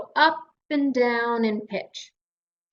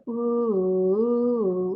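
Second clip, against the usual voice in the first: flat ooh. Shown as a demonstration. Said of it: "not much difference".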